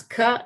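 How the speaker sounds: "ka" as a separate crisp K released into a short vowel; isolated sound demo. Shown as a demonstration. Clinician breaking a word into movements, isolated "ka". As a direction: pop the K of "ka" on its own. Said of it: "just even saying the word can, I'm doing".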